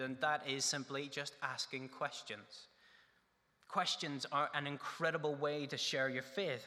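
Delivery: ordinary talk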